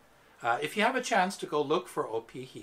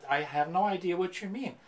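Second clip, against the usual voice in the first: ordinary delivery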